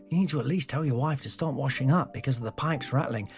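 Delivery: usual speaking voice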